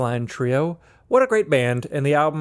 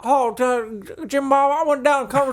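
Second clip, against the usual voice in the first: put-on giddy Southern good ol' boy bragging about his truck run; excited Southern accent; 12 semitones higher than usual. Podcast host riffs as an excited Southerner in exaggerated accent. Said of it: "I'm getting excited" accent".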